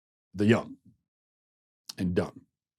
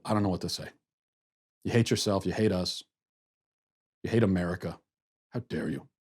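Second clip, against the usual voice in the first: softer, hammed-up hurt guilt trip; a hint of mock despair, dropping softer to the end; mock heartbroken; 5 semitones lower, 7 dB quieter, faster than usual. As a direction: lay on the wounded disappointment, quietly, as a joke. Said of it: "I'm getting emotional".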